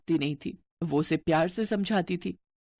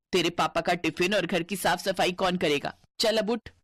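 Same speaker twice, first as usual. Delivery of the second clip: ordinary delivery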